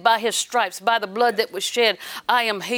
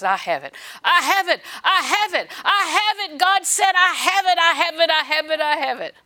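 rising higher and louder, laughing joy; building confession; 6 semitones higher, 3 dB louder than usual. Shown as a demonstration. Preacher showing the church how to confess, building. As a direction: build each "I have it" with growing excitement. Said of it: "Talk about the promise".

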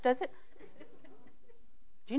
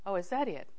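unconcerned; 2 semitones lower, 10 dB quieter than usual. Retold or imitated. Speaker answers a screaming mother, quietly unconcerned. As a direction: play it unbothered and quiet. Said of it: "Totally unconcerned".

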